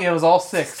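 ordinary talk

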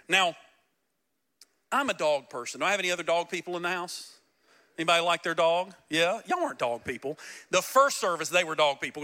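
ordinary delivery

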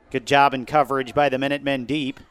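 ordinary delivery

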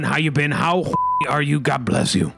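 ordinary talk

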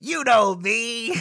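resentful werewolf, drawn out slowly. Acted. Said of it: "There was resentment in the werewolf's voice".

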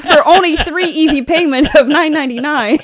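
loud, high infomercial pitch, laughing; hard sell ad. Acted. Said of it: "like, an infomercial".

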